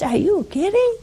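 a high, incredulous "are you kidding"; mock disbelief. Mock disbelief at doubting angel.

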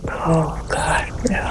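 hushed, horrified gasp of mock dismay; retold horror, sunk nearly to a whisper. Horrified sister reacting to the family's dinner talk, hushed mock horror. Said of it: "She was horrified".

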